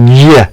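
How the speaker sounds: "G" said between juh and guh, like gyuh; in-between G sound. Shown as a demonstration. Showing his muddled Gimel attempt, "G" as a gyuh. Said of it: "something kind of in the middle".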